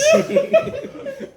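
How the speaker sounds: high real laugh. Real, not acted. Comic laughing mid-story, high laugh. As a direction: break into laughter.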